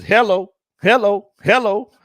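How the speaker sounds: loud, high, sing-song hellos. Retold or imitated. Host greeting chat with a loud, high hello. Reading a sung hello comment.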